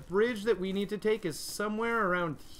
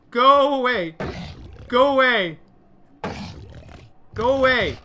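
raised, high-pitched shooing; insistent shouting; 8 semitones higher, 15 dB louder than usual. Real, not acted. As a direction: shout to drive the enemy off. Shooing piggy in game.

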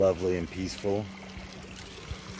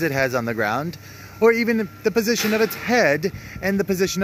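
hammed-up British accent, pitched higher; mock British accent; 8 semitones higher than usual. Vlogger playing Johnny Top Hat, mock British accent. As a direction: play a cartoon Brit, chirpy and over the top. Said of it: "and I have an accent".

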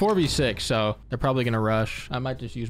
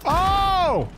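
sudden high yelp; surprised yelp; 4 dB louder than usual. Real, not acted. Gamer yelps at a sudden kill, surprised. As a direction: blurt it out in shock.

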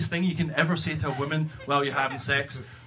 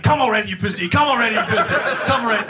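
louder, higher, impatient egging-on; goading inner voice; 4 semitones higher, 5 dB louder, faster than usual. Acted. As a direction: goad yourself like an impatient inner voice. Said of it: "the other voice is going like".

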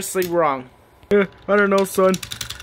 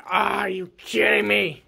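usual speaking voice

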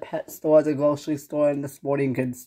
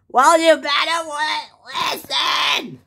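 high, screechy warning shout; yelling boss; 12 semitones higher, 4 dB louder, slower than usual. Acted. Boss warning the worker to listen, yelling. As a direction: lose it and yell. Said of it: "he yells at me a lot".

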